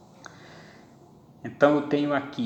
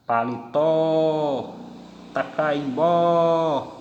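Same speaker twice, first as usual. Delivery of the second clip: Paletó and tacaimbó stretched out, louder on the final tó and bó; slow stress demo; 2 semitones higher, 3 dB louder, much slower than usual. Shown as a demonstration. Teacher showing the class words ending in stressed O. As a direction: drag the words out and hit the final syllable. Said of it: "São oxítonas terminadas na letra O".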